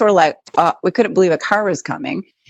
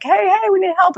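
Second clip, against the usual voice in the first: much higher pretend hail; mock calling for help; 14 semitones higher than usual. Wife shows host how they didn't hail the truck, mock calling out. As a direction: pitch it up as if flagging someone down. Mimes flagging down a driver.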